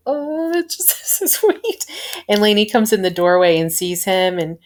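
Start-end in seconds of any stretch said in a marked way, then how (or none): 0.1-1.8 very high, slow coo breaking into laughter; gushing squeal. Retold or imitated. Fan re-enacting her reaction to a student's praise, gushing squeal. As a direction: squeak it out, melting at the sweetness. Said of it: "And I was like".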